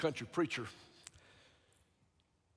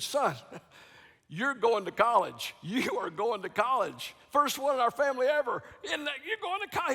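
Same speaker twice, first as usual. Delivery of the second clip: higher, laughing joyful announcement; excited father; 7 semitones higher than usual. Retold or imitated. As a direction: burst out with the happy news. Father's excited news in story.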